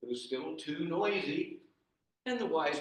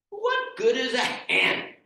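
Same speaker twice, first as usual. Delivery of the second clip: louder, higher, slow incredulous question; scoffing question; 9 semitones higher, 6 dB louder, slower than usual. Acted. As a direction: scoff at the silly advice. Peter scoffs at the advice.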